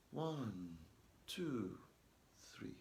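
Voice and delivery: soft, slow count; counting softly. Shown as a demonstration. Teacher showing pupils a soft calming count. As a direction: count under your breath to calm down. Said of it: "count to three in your head".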